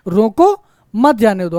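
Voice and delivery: "रोको" rising and cut off by a pause, then "मत जाने दो" falling; रोको, brief stop, मत जाने दो; pause after रोको. Shown as a demonstration. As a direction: break clearly after रोको, keep मत with जाने दो. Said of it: "अर्थात उसको रोकिए".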